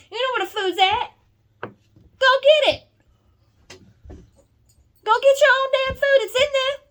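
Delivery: furious high-pitched shout; angry shout. Real, not acted. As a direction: blow up, order them to fetch their own food. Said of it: "think it's funny to see me angry".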